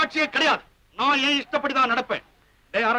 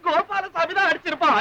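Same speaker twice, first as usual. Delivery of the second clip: higher, laughing as he speaks; delighted laugh; 4 semitones higher than usual. Acted. Hearing who sent the telegram, laughing delightedly. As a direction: break into gleeful laughter on the words.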